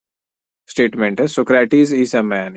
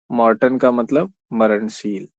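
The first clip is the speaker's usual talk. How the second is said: usual speaking voice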